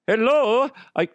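high, hammed-up German accent; German swim instructor. German swim instructor saying hello, high mock German accent. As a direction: greet brightly in a comic German accent. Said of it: "German swim instructor".

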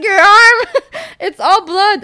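loud, very high laughing imitation; mock alarm through laughter. Cop spotting blood on her arm, mock-alarmed laughing shriek.

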